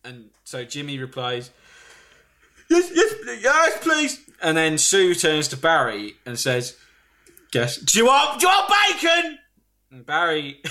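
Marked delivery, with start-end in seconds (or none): 2.7-4.2 high, laughing, keen yes please; eager Jimmy voice. Retold or imitated. 7.5-9.4 high shouted offer of bacon; shouted question. Retold or imitated. Sue yells question at Barry.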